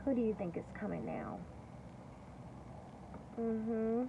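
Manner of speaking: usual speaking voice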